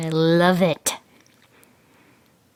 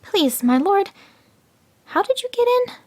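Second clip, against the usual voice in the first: innocent jackal; 13 semitones higher than usual. A narrator's reading.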